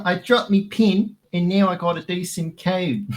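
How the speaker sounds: hammed-up New Zealand accent, pitched high; Kiwi accent. Shown as a demonstration. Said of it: "We do a Kiwi one".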